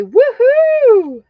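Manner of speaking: high, loud woohoo; excited cheer. Pretend.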